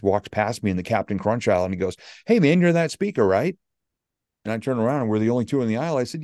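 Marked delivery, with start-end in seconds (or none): 2.3-3.6 higher, louder hail of someone recognized; excited stranger. Retold or imitated. Man in grocery aisle recognizes the speaker, higher excited hail. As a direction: imitate a fan calling out in recognition. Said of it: "I had a guy come up to me".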